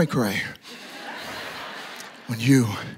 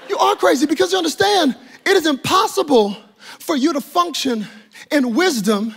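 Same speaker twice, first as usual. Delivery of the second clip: normal talking voice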